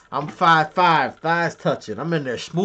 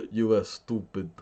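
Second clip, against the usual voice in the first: mock Russian accent after a laugh; softer mock Russian gloating; 4 semitones lower, 5 dB quieter than usual. Russians laughing at the U S, in a mock Russian accent. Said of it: "Beautiful Russian accent".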